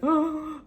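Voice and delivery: short high imitated gasp; mock gasp. Retelling crying in counseling with no tissues, mock gasp. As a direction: gasp in pretend shock.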